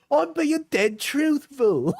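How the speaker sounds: very high, drawn-out mock Cockney; attempted Cockney. Streamer acting out a partner's insults, mock Cockney. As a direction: stretch it out in a hammed-up, high Cockney. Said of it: "It's Cockney, I think".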